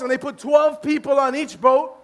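ordinary talk, nothing marked

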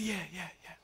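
a shy little godson agreeing quickly; small kid voice. Retold or imitated.

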